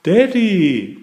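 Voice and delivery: high, stretched call over noise. A narrator's reading. Peppa to Daddy over helicopter noise, calling out. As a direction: call to someone over a roaring engine. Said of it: "called Peppa over the noise of the helicopter".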